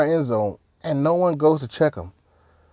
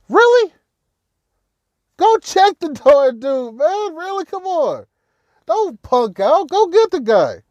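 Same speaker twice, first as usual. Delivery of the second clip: exasperated yelling; 9 semitones higher than usual. Real, not acted. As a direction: yell at them to fight back. Yelling at his own players.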